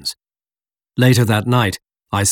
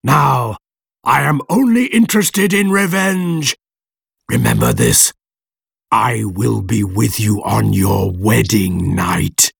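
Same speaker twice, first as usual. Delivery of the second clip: vengeful monster; slower than usual. A narrator's reading. Creature promises revenge on the wedding night, menacing monster voice. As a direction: swear revenge, sinking into a slow threat.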